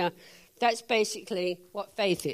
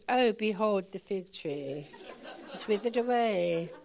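put-on slow, softer, pompous old-English read; mock King James; 4 semitones lower, 6 dB quieter than usual. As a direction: say it like stiff scripture, slowly. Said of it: "didn't stand there and said".

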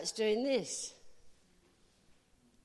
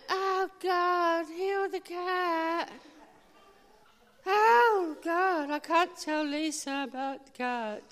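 higher, louder, pleading slowly; desperate plea, slowed; anguished prayer; 7 semitones higher, 5 dB louder, much slower than usual. Retold or imitated. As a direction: cry out to God in distress. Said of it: "And I'm like".

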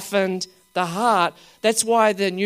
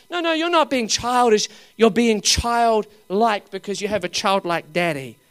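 normal talking voice

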